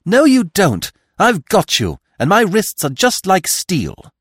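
loud, high, triumphant cry; girl seizes the attacker, ringing and loud; clear, ringing tones. A narrator's reading. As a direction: call out firmly as she grabs the attacker. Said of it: "in Cinderella's clear tones".

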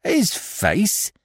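high, incredulous echo; indignant. A narrator's reading. Prisoner is asked about a face, indignant. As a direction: throw the question back, affronted. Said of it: "cried Billy indignantly".

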